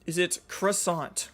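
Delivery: slow, careful "Is it croissant?" with every sound spelled out; pronounced very clearly. Shown as a demonstration. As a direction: enunciate "croissant" syllable by syllable. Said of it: "Pronounced very clearly".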